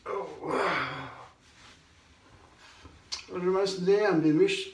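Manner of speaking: ordinary delivery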